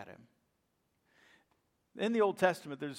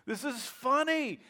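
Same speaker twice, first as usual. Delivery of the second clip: laughing delight; 8 semitones higher, 5 dB louder than usual. Retold or imitated. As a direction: chuckle it out, tickled by the news.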